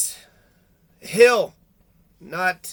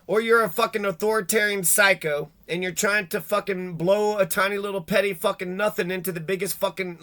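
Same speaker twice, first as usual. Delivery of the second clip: ordinary talk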